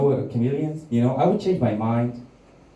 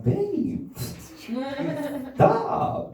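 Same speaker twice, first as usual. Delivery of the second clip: mock pained plea. Pleading girlfriend to stop hitting.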